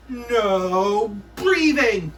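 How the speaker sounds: slow, drawn-out command; strict teacher bark. Retold or imitated. Teacher orders the class, slow bark. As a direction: stretch the order like a tyrant.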